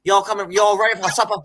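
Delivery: a hint of a Southern accent, calling the family to supper; imitating Southern accent. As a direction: lightly play a Southern mother-in-law calling to supper. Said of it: "she talks, like, completely Southern".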